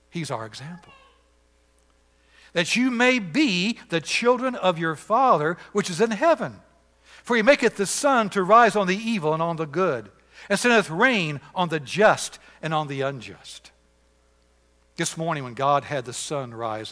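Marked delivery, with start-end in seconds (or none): 2.5-13.7 solemn scripture reading. Acted.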